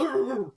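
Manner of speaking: loud, high mimicked roar; imitated wrestler's roar. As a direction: let out a short wrestler's roar. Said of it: "After he goes".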